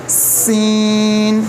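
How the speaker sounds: सीन held long and firm; lengthened letter. Shown as a demonstration. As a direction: draw out सीन with a firm hiss. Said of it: "थोड़ा सख्त करके लंबा करके पढ़ना है".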